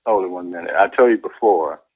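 usual speaking voice

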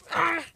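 short, loud, high scream; frightened yell. Pretend. Player fleeing a killer, frightened yell. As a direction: yelp in alarm.

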